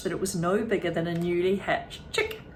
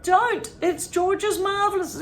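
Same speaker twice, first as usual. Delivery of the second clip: high, louder urgent protest; panicked boy; 12 semitones higher, 5 dB louder, slower than usual. A narrator's reading. George trying to stop Grandma, high and urgent. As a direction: blurt it out in alarm.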